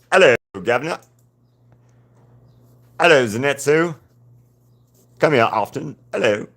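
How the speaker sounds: a hint of a cheeky English-Australian greeting. Streamer voices her drawing in an English-Australian accent. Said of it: "English slash Australian type voice".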